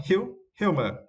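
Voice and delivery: "Hu-, humor" with HU pushed up high; false start, then hit the first syllable of "humor" hard; stress on HU. Shown as a demonstration. Teacher to students, stressing the first syllable of "humor". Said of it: "we need to stress the first syllable".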